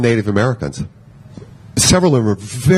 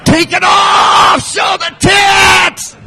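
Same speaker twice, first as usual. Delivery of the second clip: high, slow rowdy heckling shouts; much slower than usual. Retold or imitated. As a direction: yell it like a rowdy crowd. Shouting men, visual type.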